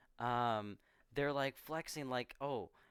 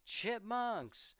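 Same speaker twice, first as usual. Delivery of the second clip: retold yell; 10 semitones higher than usual. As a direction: call it out high, holding back volume. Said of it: "he yells".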